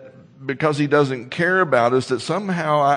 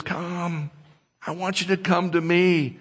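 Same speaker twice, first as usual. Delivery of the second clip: gentle invitation; 4 semitones higher than usual. Retold or imitated. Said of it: "But he says".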